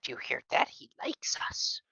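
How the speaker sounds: softly thrilled whisper. Acted. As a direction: whisper it so the dog can't hear. Excited aside to partner.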